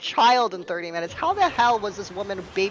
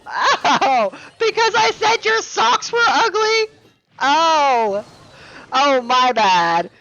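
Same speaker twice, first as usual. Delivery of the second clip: much higher, much louder, laughing disbelief; incredulous mock outrage; 7 semitones higher, 10 dB louder, much slower than usual. Real, not acted. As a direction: blast it out in laughing sarcastic shock. Mocking her bridge overreaction.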